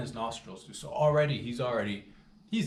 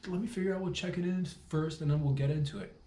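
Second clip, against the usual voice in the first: rushed brush-off; 7 semitones higher, 3 dB louder, faster than usual. Retold or imitated. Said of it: "I was just like".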